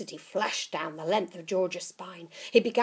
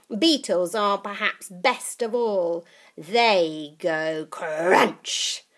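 put-on old witch voice; 8 dB louder, slower than usual. Grandma relishing beetles.

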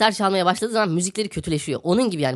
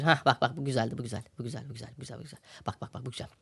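much softer, muttered half under breath; to himself; 6 semitones lower, 10 dB quieter, faster than usual. Real, not acted. Host muttering to himself over a find, much softer. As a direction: murmur it almost to yourself.

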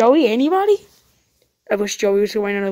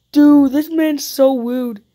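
exasperated; 3 semitones higher, 7 dB louder, much slower than usual. Real, not acted. Annoyed at character kicking him.

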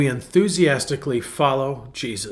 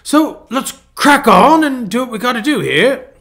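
higher, bright mock-British renovator voice; imitating British accent; 8 semitones higher, 4 dB louder than usual. Pastor imitating the British renovator's pep talk in a British accent. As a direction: do the cheery British builder. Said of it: "so he tells you what he's gonna do".

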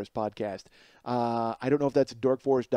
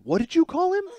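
very high, louder affronted question; 7 dB louder than usual. Retold or imitated. Friend's wife hearing her husband's nickname, affronted. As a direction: imitate a wife's indignant disbelief.

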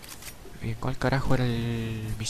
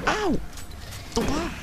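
startled counterattack; slower than usual. Real, not acted. Hit, then strikes back.

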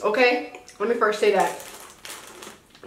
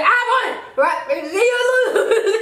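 gleeful laughing; 8 semitones higher than usual. Real, not acted. Winning rock paper scissors.

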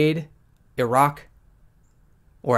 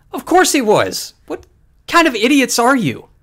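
loud, higher, exasperated; exasperated outburst; 9 semitones higher, 6 dB louder than usual. Real, not acted. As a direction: shout at the naive crowd in disbelief. Exasperated rant at naive people.

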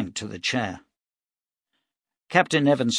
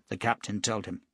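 usual speaking voice

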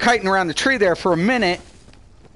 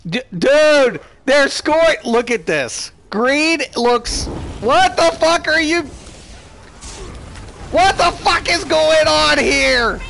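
furious shout; 10 semitones higher than usual. Real, not acted. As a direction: yell in disbelief at the chaos. Chaos in the fight.

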